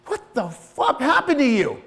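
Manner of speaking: high, drawn-out indignant demand; appalled confrontation. Retold or imitated. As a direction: slowly berate yourself in disbelief. Confronts his own body in disgust.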